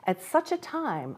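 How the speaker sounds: plain reading voice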